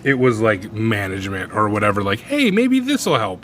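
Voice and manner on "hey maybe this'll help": high, slow sing-song suggestion; mock helpful manager. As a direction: play the eager boss.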